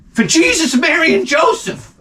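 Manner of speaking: exasperated. Real, not acted. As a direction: throw the names up in exasperation. Exasperated high exclamation.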